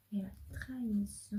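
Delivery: whispered, unvoiced; hushed whisper. Real, not acted. Reader revealing the betrayal card, hushed whisper.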